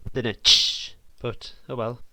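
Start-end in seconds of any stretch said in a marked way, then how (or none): 0.1-0.9 a breathy hi-hat tsh; unvoiced tsh sound. Shown as a demonstration. Making a song, mimics a hi-hat with a tsh. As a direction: hiss out a short hi-hat tsh. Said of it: "This is gonna be, like, more of a".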